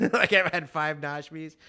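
laughing loudly through the words. Real, not acted. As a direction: crack up as you say it. Laughing praise of Nashmi.

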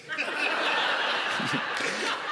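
airy breathless chuckle; winded preacher's laugh. Real, not acted. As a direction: laugh while catching breath. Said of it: "because I'm out of breath".

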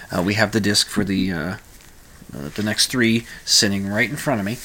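ordinary talk, nothing marked

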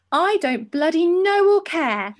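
mock angry inner shout. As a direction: spit it out slowly, fed up. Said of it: "my inner voice shouted loudly".

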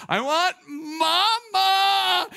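put-on high, drawn-out toddler wail for mom; toddler meltdown scream. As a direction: scream it like a tantruming two-year-old. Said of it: "start screaming".

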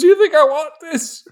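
an overwrought dad pleading; mock anguished dad. Dad screaming at his child that it's for them.

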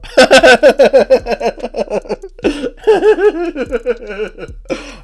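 long loud high-pitched laughter. Real, not acted. Laughing at his own slip while talking about Bossy, loud and high. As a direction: crack up at yourself.

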